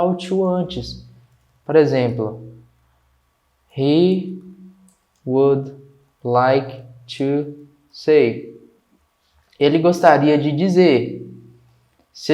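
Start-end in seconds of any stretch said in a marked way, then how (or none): 3.8-8.6 each word on its own, with pauses; slow example for learners, separated words; word-by-word model. Shown as a demonstration. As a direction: spell the sentence out word by word.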